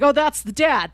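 usual speaking voice

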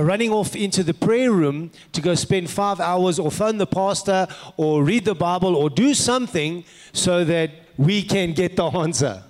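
ordinary talk

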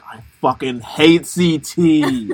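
slow, drawn-out mock bitterness; bitter. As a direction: spit out a slow, bitter imitation. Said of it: "bitter post confessionals ever".

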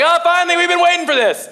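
eager townsperson. Retold or imitated. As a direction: greet the news like you've waited forever. Eager townspeople welcoming good news.

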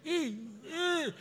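soft, high mock whimper; weeping like a baby. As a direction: whimper it in a put-on tearful voice. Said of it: "weeping like a baby".

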